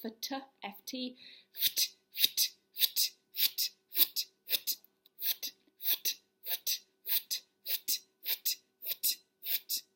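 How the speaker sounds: rapid unvoiced ft from the diaphragm; ft breath pulses. Shown as a demonstration. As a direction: pump ft ft from the belly. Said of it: "make this noise".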